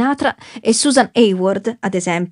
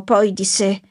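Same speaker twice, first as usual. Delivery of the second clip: usual speaking voice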